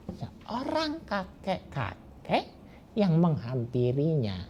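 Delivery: usual speaking voice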